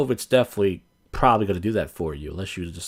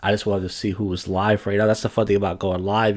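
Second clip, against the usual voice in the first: ordinary delivery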